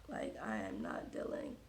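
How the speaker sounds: low whispered aside. Real, not acted. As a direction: whisper it to yourself. Whispered aside about hair.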